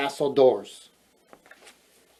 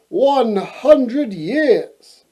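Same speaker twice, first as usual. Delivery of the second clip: astonished exclamation; 8 semitones higher, 4 dB louder, much slower than usual. Acted. Prince announcing the long sleep.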